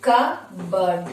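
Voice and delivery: কাপবোর্ড as kuh-burd, p silent; correct pronunciation. Shown as a demonstration. Teacher modelling the word for students, correct pronunciation. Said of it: "আমরা উচ্চারণ করব কা".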